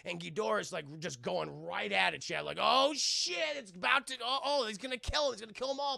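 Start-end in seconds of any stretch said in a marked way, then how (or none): 2.6-6.0 high, loud panicked voicing; mock panic, voice pitched up. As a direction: play the soldiers freaking out. Streamer voicing panicked soldiers.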